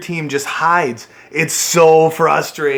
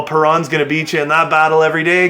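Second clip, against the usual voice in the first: usual speaking voice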